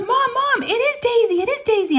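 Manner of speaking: very high-pitched frantic daughter imitation; retold screaming. Daughter telling mom it's the hamster, screaming. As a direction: squeal it urgently, mimicking a kid. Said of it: "she starts screaming".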